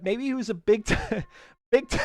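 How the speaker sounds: cracking up mid-word; real laughter cutting the words off; can't say it without laughing. Real, not acted. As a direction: break into giggles before finishing the phrase. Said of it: "I can't even say it without laughing".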